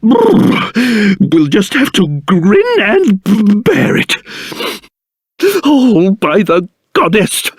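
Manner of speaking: stuttering, shuddering from the cold; shivering with cold. Acted. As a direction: force the words out through chattering shivers. Shivering in freezing weather.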